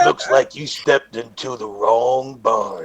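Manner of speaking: low, slow Western menace; deep, softened mock threat; grizzled cowboy. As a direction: drawl it slowly as a grizzled cowboy. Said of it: "I would actually be more grizzled".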